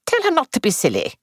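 quick and brisk; crisp. A narrator's reading. Mistress dismissing the maid's worry, quick and crisp. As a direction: brush the worry aside briskly. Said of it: "said Miss Marple crisply".

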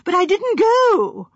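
high, loud, slow protest; high and excited. A narrator's reading. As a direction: burst out in agitated denial. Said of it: "Sanford's voice rose high and excited".

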